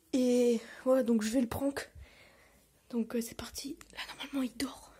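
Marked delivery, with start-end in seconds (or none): 2.9-3.8 hushed whisper, sneaking; whispering over a crib. Real, not acted. Sneaking up on sleeping brother. 3.9-4.9 soft unvoiced whisper; whispering over a crib. Real, not acted. Sneaking up on sleeping brother.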